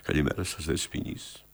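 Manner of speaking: very low, hushed greeting; restrained demonstration. Shown as a demonstration. Director shows the actor the greeting, restrained and low. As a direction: play it understated, voice dropped right down. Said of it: "να σας το παίξω εγώ".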